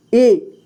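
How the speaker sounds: ए said as a brief short i; short vowel. Shown as a demonstration. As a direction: cut ए short, a quick i sound. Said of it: "छोटा ई को कम समय में पढ़ते हैं".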